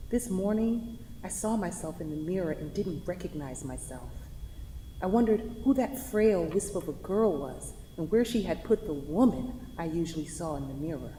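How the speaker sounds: quiet, tender voice-over reading her own letter. Acted. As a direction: speak your last letter calmly to him.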